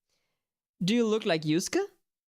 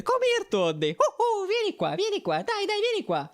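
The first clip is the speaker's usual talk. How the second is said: Mario impression. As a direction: coax Toad like a cheery plumber. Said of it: "Can you do Toad's voice?".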